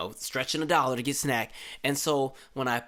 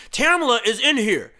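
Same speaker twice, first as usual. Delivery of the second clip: high, loud insistent shout; imitated yelling officer; 7 semitones higher, 8 dB louder than usual. Officer contradicting the center worker, imitated shout. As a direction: insist loudly in a put-on cop voice. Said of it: "you don't gotta yell".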